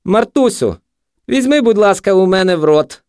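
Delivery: high, loud parody of a gentle request; exaggerated high-pitched mimicry; mocking mimicry. A narrator's reading. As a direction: ape his tone in a jeering high voice. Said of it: "перекривив його інтонацію наркоман".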